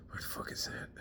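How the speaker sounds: frustrated whisper under his breath; muttered to himself. Real, not acted. Veteran forgetting a base name, whispering to himself. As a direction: whisper it to yourself, annoyed.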